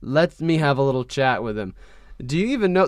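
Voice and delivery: ordinary talk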